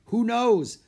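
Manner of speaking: put-on high, loud shrugging boy; young Jack, puzzled; boy's voice. Jack's voice in story.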